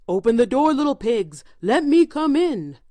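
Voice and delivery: loud, furious wolf demand. A narrator's reading. Wolf at the brick house, furious shouted demand. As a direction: shout angrily as the big bad wolf. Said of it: "made the big wolf perfectly furious".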